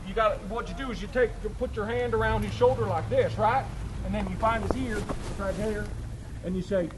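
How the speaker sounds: usual speaking voice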